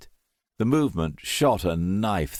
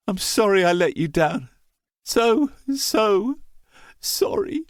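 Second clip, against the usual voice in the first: much higher and louder, voice breaking; despairing cry; 12 semitones higher, 4 dB louder than usual. A narrator's reading. Dying son cries sorry to Mum and Dad, breaking. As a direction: cry out to your family in despair.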